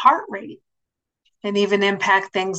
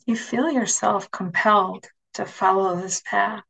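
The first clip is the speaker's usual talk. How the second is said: guided meditation. Acted. Leads a guided imagery meditation.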